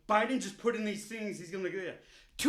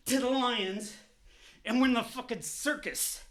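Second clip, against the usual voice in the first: ordinary talk, nothing marked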